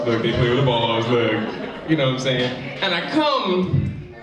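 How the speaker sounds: ordinary talk, nothing marked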